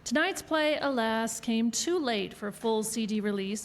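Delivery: normal talking voice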